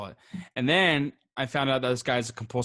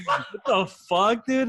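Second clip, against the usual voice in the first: higher, drawn-out disbelief, mildly acted; incredulous outburst; 8 semitones higher, 4 dB louder, slower than usual. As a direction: retell it as a baffled protest. Retelling his shocked reaction to friend.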